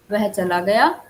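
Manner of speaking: गया with a rising question tune. Shown as a demonstration. Teacher asks it as a question. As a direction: ask "वह चला गया" with गया rising. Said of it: "यह है प्रश्नवाचक".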